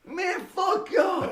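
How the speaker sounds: high, slow, shouted curse; furious drawn-out yell; angry shout. Retold or imitated. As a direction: lash out at the crowd. Angry at booing crowd.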